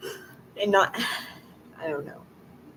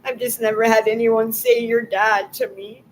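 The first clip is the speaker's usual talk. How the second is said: louder, higher, breaking into tears; real crying; 5 semitones higher, 4 dB louder than usual. Real, not acted. Never heard anyone say your dad, bursting into tears. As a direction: cry it out as it overwhelms you. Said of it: "I don't know why I'm crying".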